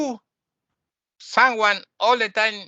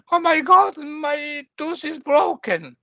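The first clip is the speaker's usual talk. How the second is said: much higher, louder mock wail, slowing on the tooth; hammed-up panic; 9 semitones higher, 3 dB louder, slower than usual. Teacher mocking someone falling apart over a tooth, higher louder wail. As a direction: overplay the dismay of a broken tooth.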